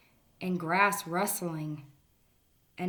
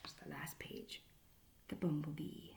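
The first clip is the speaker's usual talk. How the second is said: soft voiceless aside; whisper; 3 semitones lower, 13 dB quieter than usual. Real, not acted. Reading to the kids, whispering about the last page. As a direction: drop to a whisper at the end. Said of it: "whispered aside".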